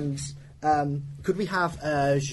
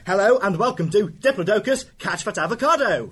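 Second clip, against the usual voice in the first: announcer shout; 6 semitones higher, 5 dB louder than usual. Acted. Show host opening announcement.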